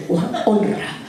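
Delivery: mock a haughty wife's scorn; scornful mimicry of Michal. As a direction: hand on hip, sneering it. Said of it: "se puso la mano a la cintura y dijo".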